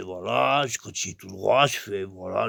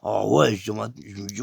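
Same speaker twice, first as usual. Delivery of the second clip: usual speaking voice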